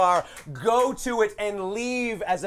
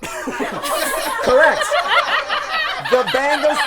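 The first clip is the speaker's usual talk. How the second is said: laughing; 11 semitones higher, 6 dB louder, much slower than usual. Real, not acted. Host laughs at own slip.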